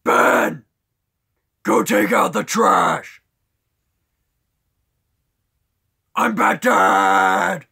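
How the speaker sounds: loud, high-pushed masked-dad shout; Bat Dad. Shown as a demonstration. Masked father ordering his son Ben, shouted Bat Dad voice. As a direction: bellow it and stretch the last word. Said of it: "I can also kinda do a bad dad".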